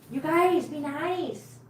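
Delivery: high, swooping gentle reproach; mild pet scolding. Real, not acted. Carer to squabbling cats, mild scolding.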